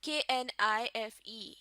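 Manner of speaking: spelling the letters k-n-i-f-e one by one; spelled out. Shown as a demonstration. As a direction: spell out کے این آئی ایف ای letter by letter. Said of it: "اس word کے spelling پر غور کریں".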